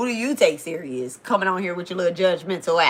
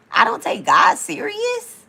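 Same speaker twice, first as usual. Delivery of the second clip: high, slow, disbelieving echo of the comment; incredulous; 12 semitones higher, 6 dB louder, slower than usual. Real, not acted. Streamer repeats a viewer's insult back, incredulous. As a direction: scoff at the accusation in disbelief.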